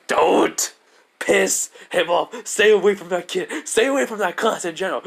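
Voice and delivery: urgent warning. Retold or imitated. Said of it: "All For One's like saying".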